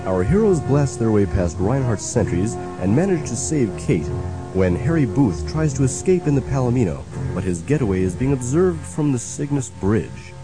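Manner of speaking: normal talking voice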